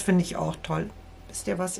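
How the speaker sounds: frog in throat, voice catching; talking with a frog in the throat. Real, not acted. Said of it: "Ich hab was im Hals".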